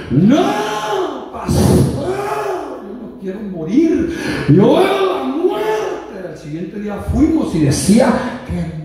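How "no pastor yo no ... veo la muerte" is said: drawn-out, high cry to the pastor; retold tearful plea. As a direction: imitate a dying girl's high wail. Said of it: "empieza a llorar y dice". (súplica llorosa imitada)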